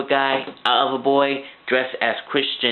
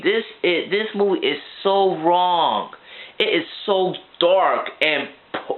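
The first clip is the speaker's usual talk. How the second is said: ordinary talk, nothing marked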